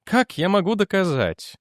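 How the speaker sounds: a touch of tired helplessness; weary. A narrator's reading. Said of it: "устало спросил Дженнек". (устало)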